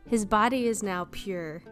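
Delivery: normal talking voice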